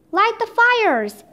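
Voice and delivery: high, drawn-out command; storyteller's stretched-out shout; narrated shout. A narrator's reading. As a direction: call the order out slowly, without full volume. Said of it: "shouted Leonardo".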